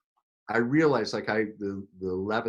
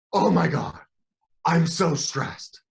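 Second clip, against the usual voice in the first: a light, louder impression of a dramatic reality star; flamboyant TV guy; 5 semitones higher, 5 dB louder, slower than usual. Imitating Luis on the reality show, lightly flamboyant. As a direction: drag out the stress with mock drama. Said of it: "Very flamboyant".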